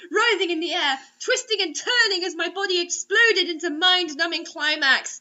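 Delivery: normal talking voice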